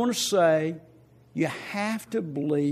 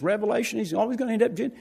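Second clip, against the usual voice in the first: ordinary talk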